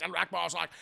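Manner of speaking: ordinary talk, nothing marked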